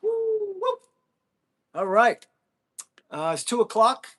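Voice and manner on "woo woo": high, loud whoop of joy; excited whoop. Real, not acted. Streamer cheering at a gift, high and loud whoop. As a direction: let out a big happy whoop.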